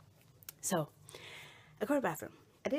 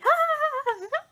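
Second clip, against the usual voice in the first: high, loud imitated shriek; long, high-pitched mock scream; 12 dB louder than usual. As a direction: mimic distant people screaming. Retelling the others' cry upstairs.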